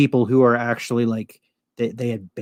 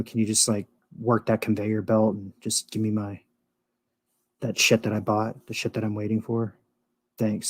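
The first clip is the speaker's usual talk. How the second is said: muttered aside; 2 semitones lower, 7 dB quieter than usual. Retold or imitated. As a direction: grumble it quietly at the cashier. Imagined muttering at checkout clerk.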